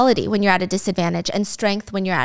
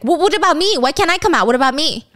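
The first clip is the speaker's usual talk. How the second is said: mock panicked whine, pitched up; panicky plea; 5 semitones higher, 4 dB louder than usual. Jailed Michael panics as his boss walks free, mock panicked plea. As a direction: play the jailed man begging not to be left behind. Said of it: "Michael starts to panic".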